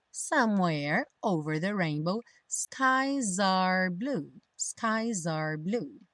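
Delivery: slow, stretched model reading; pronunciation practice. Shown as a demonstration. Teacher leading learners through lyrics, slow pronunciation practice. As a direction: draw out every phrase for learners to copy. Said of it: "pra prática da pronúncia".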